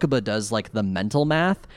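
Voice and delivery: usual speaking voice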